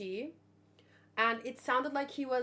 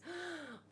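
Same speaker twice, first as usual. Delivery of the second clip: gasp; 5 semitones higher, 12 dB quieter than usual. Real, not acted. Sees the manga cover.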